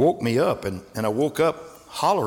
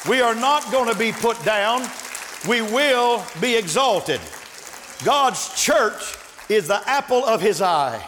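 higher, louder, building preacher's cry; preaching at full force; 9 semitones higher, 3 dB louder than usual. Acted. Preacher firing up his congregation in full force. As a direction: lift the church with rising fervor.

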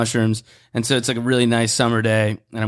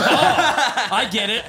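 high, laughing "oh, I get it"; laughing realization; 8 semitones higher, much slower than usual. Retold or imitated. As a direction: laugh through the sudden penny-drop. Nervous realization retold while laughing.

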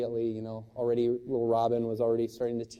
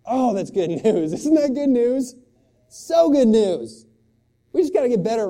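stretched, beaming shouts of joy; joyful exclaim; 10 semitones higher, 11 dB louder than usual. Real, not acted. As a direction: burst out in delight at good news. Preacher rejoicing over the gospel.

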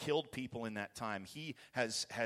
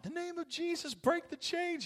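very high, strained cry without full volume; stifled scream of prayer, pitched way up; hammed-up high-pitched prayer cry; mock inner scream. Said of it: "Inside, I'm screaming out".

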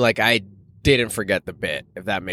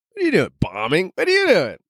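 much higher, breezy and offhand; casual on stage; 7 semitones higher, faster than usual. Retold or imitated. Comic bombing on stage casually asks friend what he's up to, breezy. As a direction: chat as if you aren't bombing at all. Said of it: "Just casually on stage".

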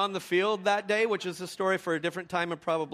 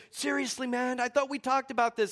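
higher-pitched mock exasperation; indignant, pitched-up complaint; retold angry coach; 7 semitones higher than usual. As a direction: imitate an exasperated rival coach. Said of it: "the coach is so angry".

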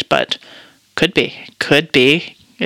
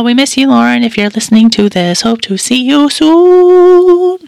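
affectionate call; 8 semitones higher, 5 dB louder than usual. Real, not acted. Podcaster calling out to her absent co-host, fond and sing-song. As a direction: stretch the last words out fondly.

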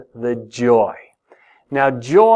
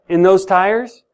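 ordinary talk

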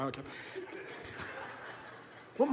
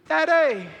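put-on girl's call; 8 semitones higher, 4 dB louder than usual. Daughter calls through noise.

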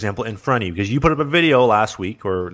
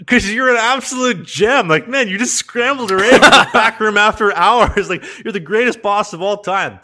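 mock gushing praise; 10 semitones higher, 7 dB louder than usual. Praising the boss to his face, hammed-up gushing. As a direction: gush sarcastically at the boss. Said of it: "And I'm like".

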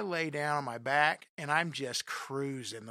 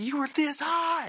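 much higher, louder amazed shout; excited friends; 12 semitones higher, 8 dB louder than usual. Retold or imitated. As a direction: yell it like a buddy who can't believe it.

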